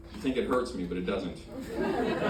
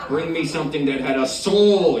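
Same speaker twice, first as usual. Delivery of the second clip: louder, higher, impassioned demand; demanding order; 5 semitones higher, 7 dB louder than usual. Acted. Diner demanding decadent food from the waiter, loudly. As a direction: belt out the order, swelling on "soul".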